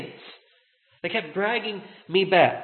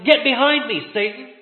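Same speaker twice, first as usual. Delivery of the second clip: loud, higher-pitched retold command; retold rebuke; 5 semitones higher, 6 dB louder than usual. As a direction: deliver Jesus's rebuke with sudden force. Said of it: "So Jesus rebukes him".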